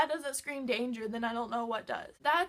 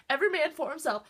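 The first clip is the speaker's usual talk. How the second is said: mock battle cry; 3 semitones higher, 8 dB louder, faster than usual. Fleeing up the stairs.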